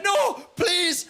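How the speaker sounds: high, loud desperate plea; pleading shout. Retold or imitated. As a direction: beg at the top of your voice.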